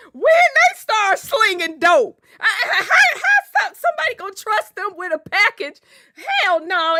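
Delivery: mock-scandalized auntie hollering over dope slinging; auntie's laughing shout. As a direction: yell it through laughter like an outraged old-school auntie. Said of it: "And then here she go".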